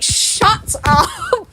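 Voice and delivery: high, slow drawn-out tease; mock outrage at the fans; playful exasperation; teasing shut up. Real, not acted. Teasing outburst.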